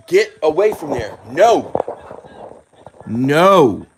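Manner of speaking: loud, high scolding; shouting at a dog. Real, not acted. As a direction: snap the dog away from it. Yelling at the dog.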